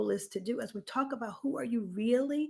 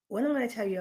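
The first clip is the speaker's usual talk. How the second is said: usual speaking voice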